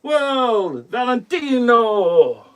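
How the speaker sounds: put-on a light, joking Italian accent to welcome Valentino; mock Italian accent. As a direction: playfully put on an Italian accent to greet the fans. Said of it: "I'm sorry about the accent".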